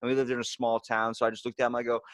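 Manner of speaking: ordinary talk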